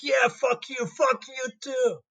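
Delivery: high, slow, put-on jeering voice; mock hostile audience; heckling crowd. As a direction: jeer back like a rowdy crowd. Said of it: "Everybody's like".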